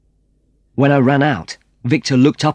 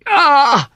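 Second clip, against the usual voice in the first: sharp high cry of pain; 5 dB louder than usual. Acted. Antonio cries out as the helmet strikes his head, high pained yelp.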